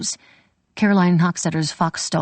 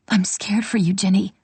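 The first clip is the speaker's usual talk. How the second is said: whispered fear; 3 semitones higher than usual. Acted. Sister whispers her fear for Ginny's safety. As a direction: breathe it, scared and close.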